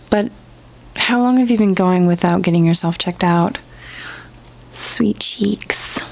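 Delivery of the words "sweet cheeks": breathy, unvoiced "sweet cheeks"; a smug whispered dig at the poster; teasing whisper. Real, not acted. Teasing pet name, whispered.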